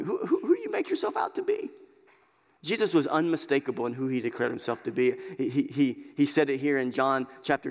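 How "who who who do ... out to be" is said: much higher, quick, needling Pharisee question; mocking high challenge.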